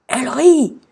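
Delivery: high, loud outraged cry; scandalised yell. A narrator's reading. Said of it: "hurla le roi d'un ton scandalisé". (hurlement scandalisé)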